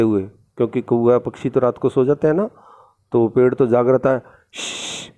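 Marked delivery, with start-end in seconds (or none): 4.5-5.1 soft unvoiced shh; quiet warning shh; shushing. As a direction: hush before speaking. Waking the crow secretly.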